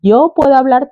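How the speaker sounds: put-on "Yo puedo hablar" lightly in gringo accent; a hint of an American accent; faint gringo accent. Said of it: "tipo como gringo".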